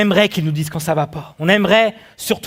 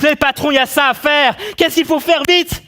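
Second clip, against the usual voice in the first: higher-pitched urgent pressing; urgent appeal; 7 semitones higher than usual. Acted. Employee telling the boss about an emergency, urgent. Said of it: "il y a une urgence".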